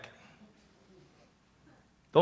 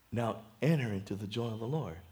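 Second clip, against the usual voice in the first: God's calm dismissal; 9 semitones lower, 12 dB quieter than usual. Acted. God calmly dismisses the disappointed man.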